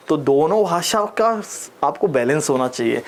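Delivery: ordinary talk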